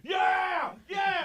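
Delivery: high, loud crowd roar of agreement; crowd shouting. Retold or imitated. Crowd backing up the angry passenger, loud shouts. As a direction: yell it like a whole mob. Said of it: "The crowd goes".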